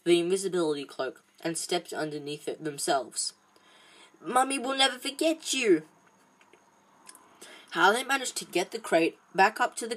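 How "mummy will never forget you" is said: high, wobbling farewell; a big man crying like a mum; tearful mother. A narrator's reading. Hagrid saying goodbye to his dragon, high and tearful.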